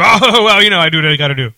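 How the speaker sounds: laughing. Retold or imitated. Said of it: "And he's like".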